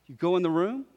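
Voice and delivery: ordinary delivery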